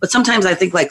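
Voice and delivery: ordinary talk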